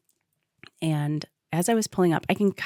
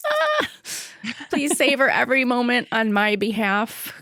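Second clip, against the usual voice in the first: pleading; 6 semitones higher than usual. Retold or imitated. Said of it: "grab her and say".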